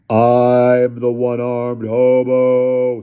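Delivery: very slow, loud drawn-out boom; bellowing. Acted. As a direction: bellow it to terrify the boys. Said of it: "he bellowed".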